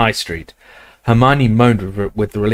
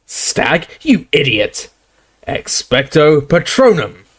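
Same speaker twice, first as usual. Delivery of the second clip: roaring barman; 5 semitones higher, slower than usual. Acted. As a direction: bellow the spell at the idiot. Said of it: "roared the barman".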